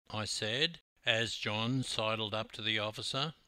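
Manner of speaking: ordinary delivery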